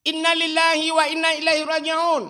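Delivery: high, loud public announcement; over a PA system. Retold or imitated. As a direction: announce it like a mosque loudspeaker. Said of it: "pegah pengumuman".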